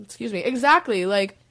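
usual speaking voice

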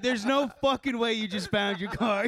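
high-pitched exasperated disbelief; incredulous outburst. Retold or imitated. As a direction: refuse to believe what you're hearing. Retelling his disbelief at friend.

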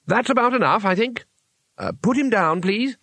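high, hesitant character voice; timid intervention. Acted. Two Flower nudged to stop the fight, timidly. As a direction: plead politely in a high, tentative voice.

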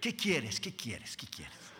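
from soft to a breathless whisper; God giving in, voice melting away; a whispered surrender, dying out; fading to whisper. Retold or imitated.

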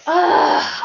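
high, loud groan of regret; frustrated groan. Real, not acted. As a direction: groan loudly at your own regret. Regretting how the night went.

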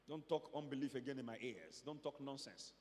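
low, soft aside; quietly scold, as if in confidence; understated, low-pitched telling-off; hushed rebuke. Acted. Acting out God's hushed rebuke.

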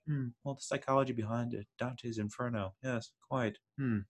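mock the cool, unbothered intellectuals; hushed, haughty and dry; refined dry aristocrat. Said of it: "plays that refined, kind of dry".

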